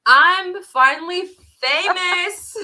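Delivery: slow, high, hammed-up brag; grand fake-proud announcement; mock boastful. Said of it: "No, just kidding".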